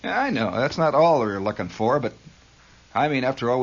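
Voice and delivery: ordinary talk, nothing marked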